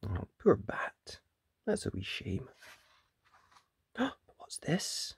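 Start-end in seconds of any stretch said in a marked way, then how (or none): none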